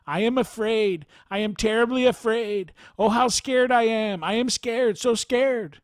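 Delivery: a terrified villager repeating her fear; frightened woman. Retold or imitated. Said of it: "a woman's voice ... kept saying".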